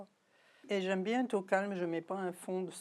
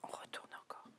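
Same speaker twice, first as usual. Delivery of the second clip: whispering; 12 dB quieter than usual. Real, not acted. Quietly giving folding steps.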